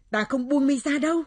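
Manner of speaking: loud, high refusal; forceful yell in a struggle; defiant shout. Acted. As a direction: shout back, refusing to give in.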